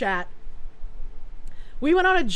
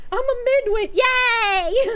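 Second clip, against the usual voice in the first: mock cheer; 9 semitones higher, 4 dB louder, slower than usual. Mock celebrating an average score.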